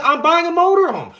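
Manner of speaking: a frustrated customer near-shouting at the bank teller; exasperated outburst. Retold or imitated. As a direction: blow up at the teller in disbelief.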